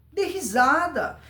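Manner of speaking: ordinary talk, nothing marked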